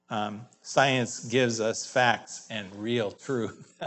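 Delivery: ordinary talk, nothing marked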